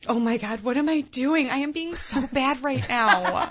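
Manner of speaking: high, squealing tipsy mom voice; giddy new mom. Acted. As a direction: gush guiltily like a mom on a night out. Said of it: "going, like".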